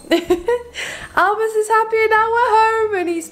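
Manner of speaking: high, slow laughing delight. Real, not acted. Owner laughs at her happy puppy back home, high laughing.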